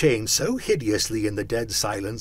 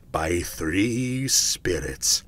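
low, very slow pronouncement; ominous ghost; 4 semitones lower, 3 dB louder, much slower than usual. A narrator's reading. Ghost announcing the three visitors, ominous. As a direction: stretch each word like a prophecy.

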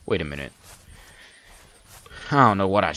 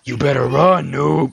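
loud, higher mock threat; in-game voice; 5 semitones higher, 7 dB louder than usual. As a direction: taunt the fleeing player loudly in character. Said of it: "that's my in-game voice".

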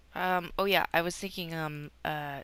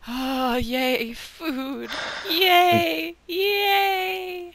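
higher, louder happy yays; cheering; 10 semitones higher, 12 dB louder, much slower than usual. Real, not acted. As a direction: cheer with delight. Cheering at food.

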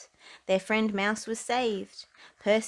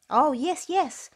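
higher, louder happy agreement; smiling yes; 8 semitones higher, 4 dB louder, slower than usual. Acted. Percy reassuring the animals, smiling eager yes. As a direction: answer with a smile. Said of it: "Percy smiled and nodded".

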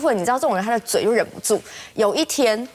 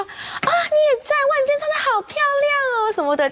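high, gushing mock praise; fake sweet compliment. Two-faced woman flattering her at the door, high gushing. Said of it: "这样一回头".

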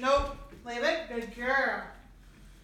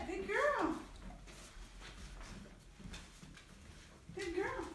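high praise voice; 9 semitones higher, 8 dB quieter, slower than usual. Real, not acted. Trainer to dog: high sing-song praise. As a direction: praise her in a bright high voice.

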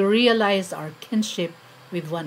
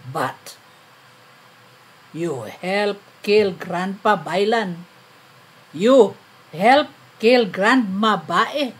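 accusing poem recital; 7 dB louder than usual. Acted. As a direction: hammer each accusation, then let the list fade away.

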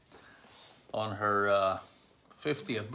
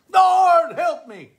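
much higher, loud plea; desperate cry; 8 dB louder, slower than usual. Acted. Sinking Peter yelps to Jesus for help. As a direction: yelp it as he goes under.

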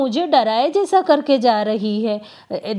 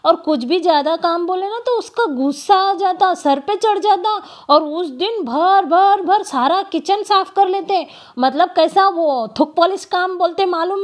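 raised pitch, indignant venting; heated complaint; 6 semitones higher than usual. Real, not acted. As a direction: pile on the grievance, voice climbing. Complaining about the maid.

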